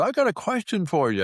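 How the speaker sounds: high mock neighbor voice; mimicking nosy neighbor. Neighbor stopping the dog walker with a question, high mock voice. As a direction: pitch it up like a curious busybody.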